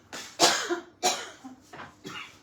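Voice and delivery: harsh hacking cough; real cough. Real, not acted. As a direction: cough into the room. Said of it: "why I'm coughing".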